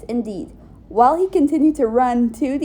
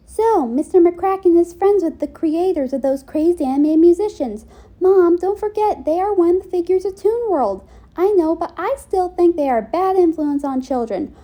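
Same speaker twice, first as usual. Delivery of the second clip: excited, higher chatter; a touch higher and louder; raised, louder character voice; 6 semitones higher, 4 dB louder than usual. Acted. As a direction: push the point a little louder.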